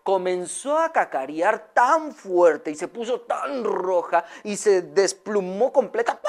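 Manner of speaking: usual speaking voice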